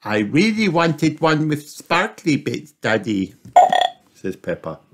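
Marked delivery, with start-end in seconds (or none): 0.0-3.3 put-on high little girl piglet asking; child piglet wondering where the jumpers are; Peppa's voice. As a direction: ask Daddy in a small high voice.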